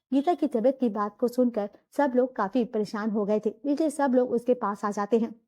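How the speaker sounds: low, even storyteller voice; story narration. A narrator's reading. Narrator telling the family story, in a low even narration.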